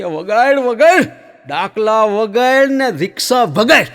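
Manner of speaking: high loud insistent trance order; hammed-up possessed woman demanding; possessed command.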